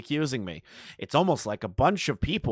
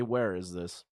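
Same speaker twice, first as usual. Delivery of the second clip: ordinary talk, nothing marked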